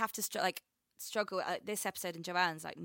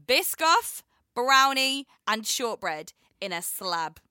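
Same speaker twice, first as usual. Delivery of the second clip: relishing list; 4 semitones higher, 8 dB louder, much slower than usual. Real, not acted. Savouring the dessert list.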